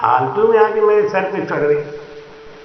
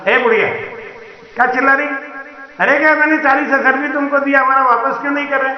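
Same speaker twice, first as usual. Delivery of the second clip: angry merchant; 6 semitones higher, faster than usual. Acted. Merchant shouts at old woman.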